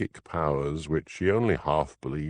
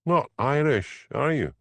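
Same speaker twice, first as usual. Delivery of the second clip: dry sarcastic jab, higher, slow; 8 semitones higher than usual. A narrator's reading. Hamish mocks Elspeth's hedging remark, slow sarcastic. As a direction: needle her with slow sarcasm. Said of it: "asked Hamish sarcastically".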